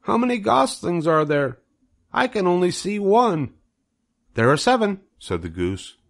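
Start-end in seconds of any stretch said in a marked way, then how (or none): 0.0-3.5 much higher, eager piglet voice; young pig Wilbur. Acted. 4.3-5.0 much higher goose voice, fussing its thanks; proud goose soaking up congratulations; fussy goose. Acted. Goose answers.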